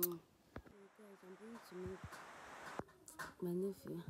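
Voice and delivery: ordinary delivery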